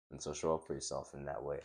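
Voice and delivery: ordinary talk, nothing marked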